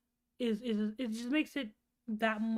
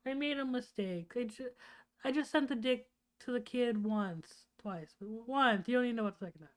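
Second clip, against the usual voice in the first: normal talking voice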